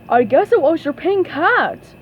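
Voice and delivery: a touch of a goofy cartoon friend; Patrick's voice. A narrator's reading. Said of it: "said Patrick".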